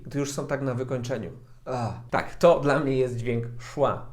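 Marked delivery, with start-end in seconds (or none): 1.7-1.9 breathy dying-zombie uh; zombie schwa groan. Shown as a demonstration. Showing the schwa sound as a zombie groan. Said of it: "co wydają z siebie zombie".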